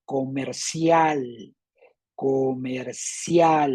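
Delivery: very slow "Comercial", CIAL stretched each time; stress on CIAL. Shown as a demonstration. Teacher showing where the force is, CIAL stressed in slow "comercial". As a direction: draw out the final CIAL of "comercial". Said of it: "la fuerza está en cial".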